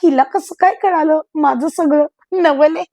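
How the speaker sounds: ordinary delivery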